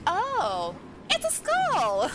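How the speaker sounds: high, drawn-out mock realisation; retold surprise. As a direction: replay the sudden discovery, pitch jumping up. Retelling own surprised realisation.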